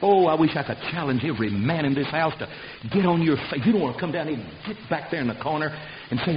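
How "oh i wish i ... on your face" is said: normal talking voice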